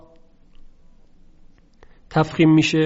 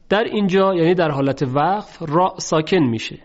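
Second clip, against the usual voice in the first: ordinary talk, nothing marked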